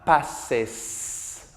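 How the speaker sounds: "passes" with a long, clearly hissed final es; clear ES ending. Shown as a demonstration. Teacher modeling third person for students, "passes" with clear es ending. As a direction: sound out the ending of "passes" distinctly. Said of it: "esta E S que suene fuerte y claro".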